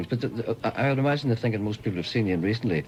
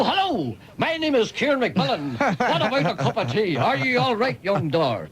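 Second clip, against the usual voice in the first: much higher, slow, hammy stage voice; overacting Northern Irish drama; 11 semitones higher, slower than usual. Shown as a demonstration. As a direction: play it like a bad theatre actor. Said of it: "They go".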